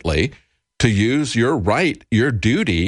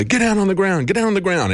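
retold frantic scream; 10 semitones higher than usual. Commentator retelling a cop's frantic orders at gunpoint, mock screaming. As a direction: imitate a frantic screamed order at talking volume. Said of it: "starts screaming frantically".

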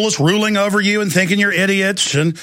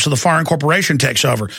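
ordinary talk

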